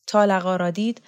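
ordinary delivery, nothing marked

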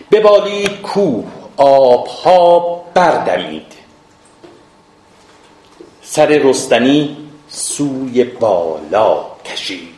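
slow, drawn-out poetic declamation; verse recitation. Acted. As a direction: recite epic verse in stretched syllables. Recites verse of epic poem.

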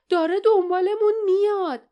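high, loud cry of alarm; frightened shout. A narrator's reading. Jasmine warns companions of the pursuing monster, high alarmed shout. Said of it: "جاسمین فریاد زد".

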